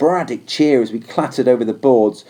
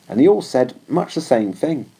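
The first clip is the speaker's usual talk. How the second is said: ordinary delivery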